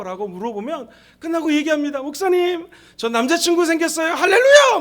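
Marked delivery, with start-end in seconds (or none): 2.0-4.8 high, loud mock-elated announcement; excited young woman. Young woman telling pastor about new boyfriend, hammed-up elation. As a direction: build to a shrieked hallelujah.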